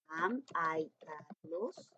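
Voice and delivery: very slow, deliberate question; slow dictation. Shown as a demonstration. Teacher modelling the question slowly for primary pupils. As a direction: lay out each word for the class. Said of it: "teacher reads example on board".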